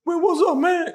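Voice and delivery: very high, loud mock angry shouting; big homeboy mad. The big homeboy demanding to know what happened, mock furious shouts. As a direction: bark it like a huge angry friend. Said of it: "He mad".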